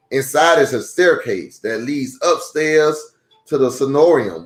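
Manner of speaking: steady, clear read-aloud voice; reading to children. A narrator's reading. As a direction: read it like a picture book for kids. Reads a children's book aloud.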